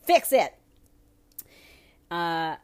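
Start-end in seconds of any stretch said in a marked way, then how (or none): 0.0-0.6 loud, high demand; shout. Real, not acted. Ranting at listeners, shouting a demand. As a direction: yell it at everyone. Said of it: "demanding everybody fix it".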